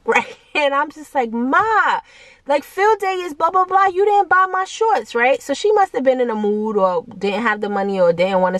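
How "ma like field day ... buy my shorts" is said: put-on higher whining kid, guilt-tripping; whiny sad plea. Kid complaining to mom late at night about the shorts, whiny. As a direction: whine at mom, pouting. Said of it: "with this sad, sad face".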